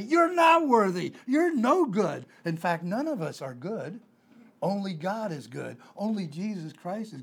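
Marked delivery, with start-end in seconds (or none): 0.0-2.3 much higher, louder, "not" and "no" punched; fiery preacher's verdict; emphatic rebuttal. Real, not acted. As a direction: declare it with force. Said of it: "preacher's blunt answer".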